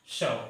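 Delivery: शव said like English shove, short a; pronounced shove. Shown as a demonstration. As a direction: say शव as "shuv", one syllable. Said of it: "The pronunciation of this word is". (शव को अंग्रेज़ी shove की तरह बोलिए)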